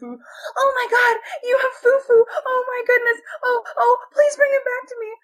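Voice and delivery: put-on very high, excited old-lady voice; old lady, overjoyed. Old lady hears her lost Fufu is found, high excited pleading. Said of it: "it's this old lady".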